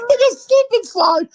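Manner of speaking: squealing high through laughter; laughing hard. Real, not acted. Friends joking about the couch, laughing high. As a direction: laugh the words out.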